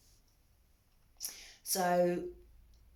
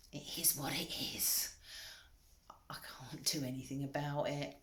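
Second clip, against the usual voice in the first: soft resigned whisper; "it is what it is" whispered, drained of voice; whispered acceptance; 3 semitones lower, 9 dB quieter than usual. Real, not acted. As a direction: let it go in a whisper. Quiet acceptance after a loud build-up.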